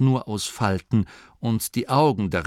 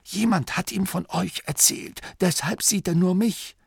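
higher, urgent aside; Dorcas voice; 10 semitones higher than usual. Acted. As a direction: explain quickly to the group in a raised voice.

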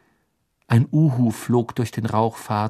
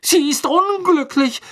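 high, indignant and slow; a girl upset on the elf's behalf; 4 dB louder than usual. A narrator's reading. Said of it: "sagte Hermine aufgebracht".

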